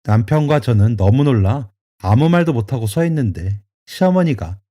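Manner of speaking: plain reading voice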